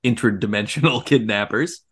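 normal talking voice

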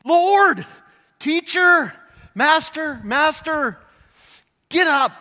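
high, loud frantic cries; panicked shouting. Retold or imitated. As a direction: yell for help as the boat sinks. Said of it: "They're going".

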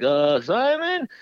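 drawn-out, high mock nervous call; imitating high-pitched worry. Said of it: "a little bit of a high-pitched tone".

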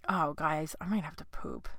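real hushed aside; barely voiced, very soft; whisper. Real, not acted. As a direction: whisper it to the audience like a confession. Confiding aside to listeners.

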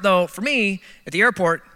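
ordinary talk, nothing marked

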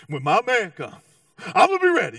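hammed-up giddy bride-to-be; excited bride. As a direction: burst into a high, loud cheer. Bride voiced, eagerly awaiting husband.

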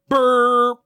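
high, held wrong-answer buzz; imitated buzzer. Riddle countdown runs out, imitated buzzer. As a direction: mimic a game show time-up buzzer.

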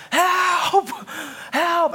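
high, loud cry for help across the water; calling for help. Retold or imitated. Father in the lake shouting to the family on shore for help. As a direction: shout it out like you're drowning and desperate. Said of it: "I pop my head up and I just say".

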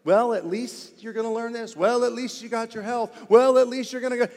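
glib, brushing-off reassurance; mock dismissive comfort. Pastor mocks what not to say to the hurting, glib. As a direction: rattle off empty consolations. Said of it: "never ... use the words "at least".

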